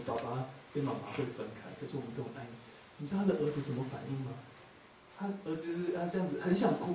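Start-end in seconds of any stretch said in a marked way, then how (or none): 0.1-2.5 softer, tender, careful; gentle reassurance. Retold or imitated. Father tells young son about divorce, softly reassuring. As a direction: break it gently to a small child.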